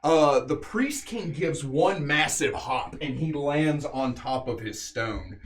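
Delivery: dramatic battle narration, leaning on the big action words; game master narration. A narrator's reading. Game master telling players of the beast's fall, dramatic narration. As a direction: tell the killing blows like an epic tale.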